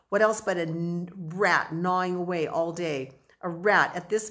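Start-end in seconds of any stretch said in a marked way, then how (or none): none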